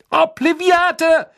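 very high, loud, drawn-out shout; shrill spell cry. A narrator's reading. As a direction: fire the spell at him. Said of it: "rief er schrill".

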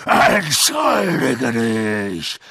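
very slow, roaring snarl; threatening, drawn-out angry bellow; furious snarl. A narrator's reading. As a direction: snarl the order, dragging it out. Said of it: "fauchte Hagrid".

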